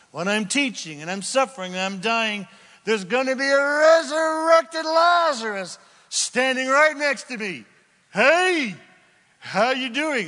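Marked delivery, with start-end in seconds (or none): 2.8-7.6 high, loud, rising excitement; excited shout. Real, not acted. Excited about resurrection. 8.1-10.3 lightly voiced folksy greeting; a hint of a Southern drawl hello; Forrest Gump. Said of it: "Did that sound like Forrest Gump".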